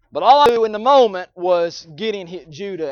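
loud, urgent preaching, pitched high; preaching at full force. Acted. Preacher to congregation, telling of his sons' fight, loud and urgent. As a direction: drive the story home to the congregation.

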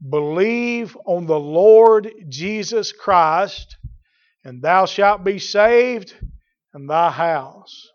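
slow, loud, measured verse reading; scripture proclamation. Real, not acted. Preacher giving the answer to the jailer, slow proclamation. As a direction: declaim each phrase with weight.

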